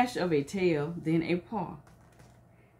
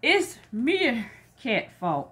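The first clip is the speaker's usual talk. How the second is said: louder, slow accusing cry; 5 semitones higher, 4 dB louder, much slower than usual. A narrator's reading. Monkeys blaming Meerkat in the story. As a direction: point the finger and cry it out. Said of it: "cried the monkeys".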